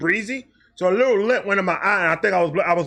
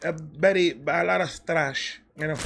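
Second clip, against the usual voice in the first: lightly imitating mom; 2 semitones lower, 5 dB quieter than usual. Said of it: "She said".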